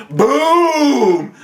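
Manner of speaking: high, loud, drawn-out boom; imitated gunshot. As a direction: boom out the second shot.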